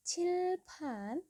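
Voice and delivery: cilpan with a puff of air on both consonants; Korean pronunciation. Shown as a demonstration. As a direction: breathe out on c and p in cilpan. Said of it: "ada huruf cil". (cilpan diucapkan dengan hembusan udara)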